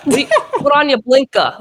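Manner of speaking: high-pitched mock New England accent; hard northern accent. Imitating northern drivers back home, hard New England accent. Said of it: "accents come out really hard like this".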